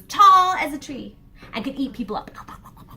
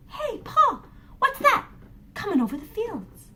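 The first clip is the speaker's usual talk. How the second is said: put-on higher curious child's voice; young boy; 11 semitones higher than usual. Boy asking his father about the giant, high child voice. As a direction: ask Pa like a small boy.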